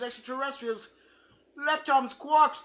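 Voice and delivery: normal talking voice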